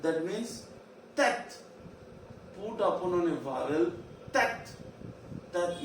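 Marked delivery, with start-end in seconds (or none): 1.1-1.6 "tet" short, high, cut off at the t; fast pronunciation. Shown as a demonstration. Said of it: "when the pronunciation is very fast". 4.3-4.7 "tet" short, high, cut off at the t; fast pronunciation. Shown as a demonstration. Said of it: "when the pronunciation is very fast".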